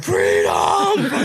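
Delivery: low mock battle cry; Braveheart yell. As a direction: bellow it like a movie hero. Braveheart movie quote.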